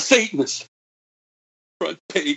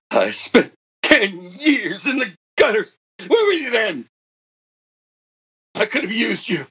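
tearful; 7 semitones higher than usual. Acted. Monologue breaks into tears.

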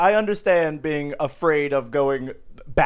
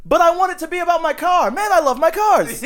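Seinfeld pitch voice; 12 semitones higher, 4 dB louder than usual. Pretend. Playing Seinfeld in pitch room.

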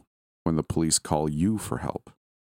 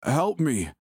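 high, anguished cry for help; anguished yell; 10 semitones higher than usual. Acted. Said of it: "the man yelled into the phone, his voice full of anguish".

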